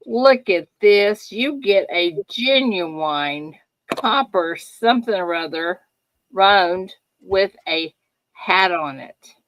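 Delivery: slow, loud infomercial showcase; salesman pitch. Pretend. Showing the viewers a prize, slow loud sales pitch. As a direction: sell it like a TV pitchman. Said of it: "showing off a prize like a salesman".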